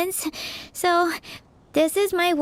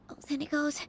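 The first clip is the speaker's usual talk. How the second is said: embarrassed mutter; 12 dB quieter, faster than usual. Acted. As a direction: catch yourself under your breath. Singer mutters correction.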